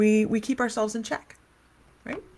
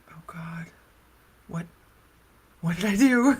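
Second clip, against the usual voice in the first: panicked whisper. Retold or imitated. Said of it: "And I'm like".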